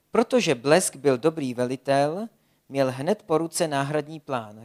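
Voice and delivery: ordinary delivery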